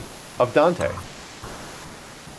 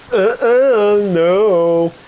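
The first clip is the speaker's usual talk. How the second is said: loud, high, drawn-out "uh-uh, no"; higher, louder mock refusal; 8 semitones higher, 7 dB louder, much slower than usual. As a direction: play an offended city refusing outright. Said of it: "Ravenna was like".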